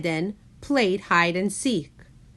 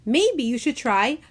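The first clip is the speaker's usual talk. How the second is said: rising, louder eager suggestion; eager interruption; 8 semitones higher, 3 dB louder than usual. A narrator's reading. Grandma cuts in to help Jonah, eager and rising. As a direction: cut in eagerly with the idea. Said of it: "Grandma Sue interrupted".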